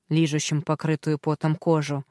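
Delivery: ordinary delivery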